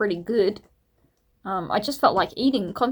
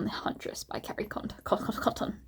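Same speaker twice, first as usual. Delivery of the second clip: whisper; 12 dB quieter than usual. Real, not acted. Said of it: "whispered stumbling name".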